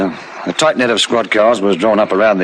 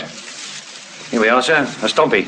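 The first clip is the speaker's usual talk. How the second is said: mild quiet find; 3 semitones higher, 5 dB quieter, much slower than usual. Acted. Searching on knees in the dark.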